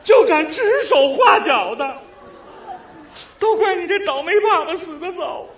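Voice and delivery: high, slow, hammed-up wailing lament; wailing widow lament. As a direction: wail like a melodramatic widow. Widow mother's mock lament.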